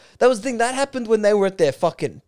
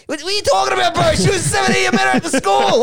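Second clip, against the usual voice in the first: mock outraged shout; 7 semitones higher, faster than usual. Retelling a footballer's reply to an interviewer, mock outraged shout. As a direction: yell it like you can't believe the question. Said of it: "It's like".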